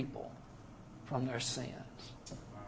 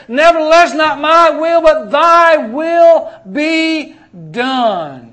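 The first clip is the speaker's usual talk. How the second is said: loud, high, slowing hard on thy will be done; fervent surrender; 10 semitones higher, 11 dB louder, slower than usual. Retold or imitated. Preacher voicing Jesus yielding to the Father, loud and slow. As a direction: stretch out the surrender in full voice.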